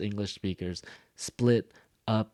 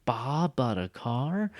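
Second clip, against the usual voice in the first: slow, long "Bob", rising question; drawn-out surprise at "Bob"; exaggerated stress on BOB; 3 semitones higher, slower than usual. Shown as a demonstration. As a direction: stretch "Bob", then question upward. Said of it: "I want you to exaggerate".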